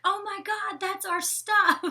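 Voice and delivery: high, loud imitation of dismay; hammed-up shocked outcry, last words drawn out; mock shock. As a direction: wail it in exaggerated horror. Acts out shocked reaction to damage.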